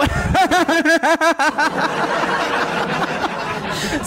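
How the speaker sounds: a real drawn-out laugh at his rival; long mocking laugh. Real, not acted. Debater laughing at his rival's ignorance, long mocking laugh. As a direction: keep laughing, openly amused.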